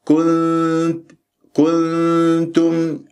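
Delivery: very slow كنتم with a long pure n hum; short u, then hold the n of كنتم before the t; clear held noon. Shown as a demonstration. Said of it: "أنت عندك نون تاء".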